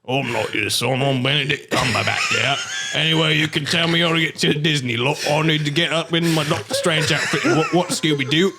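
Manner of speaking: broad mock British movie star; Benedict Cumberbatch. Cumberbatch telling off the parents, hammed-up British. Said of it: "Your accent of Benedict Cumberbatch".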